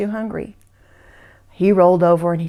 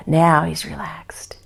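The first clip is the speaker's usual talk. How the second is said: hushed narration; 4 semitones lower, slower than usual. A narrator's reading. Storyteller settles the scene.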